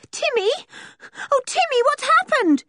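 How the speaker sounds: very high, frantic cry. A narrator's reading. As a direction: call the dog in anguish. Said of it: "she cried".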